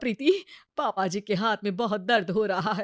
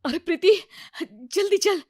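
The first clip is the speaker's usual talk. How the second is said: urgent call; 6 semitones higher than usual. Acted. Friend runs up urgently.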